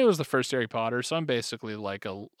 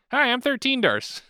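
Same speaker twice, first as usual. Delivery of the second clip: put-on high, bouncy young kid voice; thirteen-year-old kid; 9 semitones higher than usual. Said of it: "I'm thirteen-year-old Darce".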